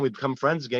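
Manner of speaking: normal talking voice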